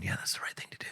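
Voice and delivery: whispered aside, very soft; talking quietly. Retold or imitated. Referee quietly approving to himself, whispered aside. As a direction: murmur it under the noise. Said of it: "talking quietly under the noise".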